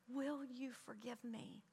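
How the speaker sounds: soft, slow retold apology; hushed, sincere asking; humble plea. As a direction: ask forgiveness very gently.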